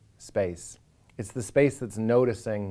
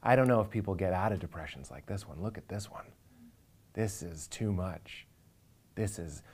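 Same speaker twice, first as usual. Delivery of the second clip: softer, sinking hushed voice of someone overwhelmed; Ram Dass; 4 semitones lower, 8 dB quieter than usual. Retold or imitated. Quoting his teacher on a hopeless depression, hushed and low. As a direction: murmur it low as though staring down a depression too big. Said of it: "he's like".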